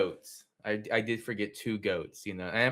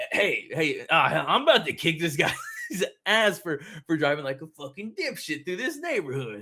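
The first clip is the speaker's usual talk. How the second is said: angry yell; 7 semitones higher, 4 dB louder than usual. Retold or imitated. Retold angry threat at driver.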